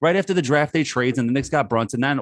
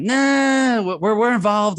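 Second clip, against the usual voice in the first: high, louder smug brush-off; mock Knicks exec; 7 semitones higher, 3 dB louder, much slower than usual. Host mocking the Knicks front office with a high smug brush-off. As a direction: play the front office bluffing. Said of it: "mocking Knicks front office".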